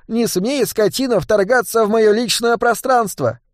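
mock yell. Boy quoting an angry fairy to thugs, mock yell. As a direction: imitate an outraged fairy in a high voice. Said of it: "как заорёт".

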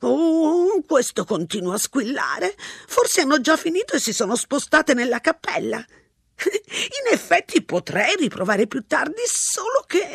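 put-on a rambling old lady, unbothered; old woman voice. A narrator's reading. Old woman at the payphone chatting, high and unhurried. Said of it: "spiegò la vecchia".

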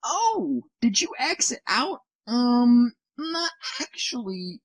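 mock Windows sweetly pretending to back off. Host mocking Windows to listeners, high fake-sweet voice. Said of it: "Windows was like".

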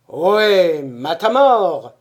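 loud, slow bellowing call; grand tragic stage delivery. Acted. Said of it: "beugla ... de sa plus grosse voix tragique".

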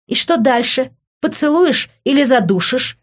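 high-pitched, edgy challenge; nervous. A narrator's reading. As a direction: dare him with nervous energy. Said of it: "нервно рассмеялась я".

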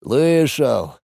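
sad sigh. Acted. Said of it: "грустно вздохнул мужчина".

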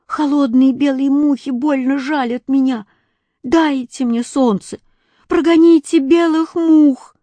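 put-on high pleading boy's voice rising to a cry; freezing child begging. A narrator's reading. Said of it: "жаловался негритёнок".

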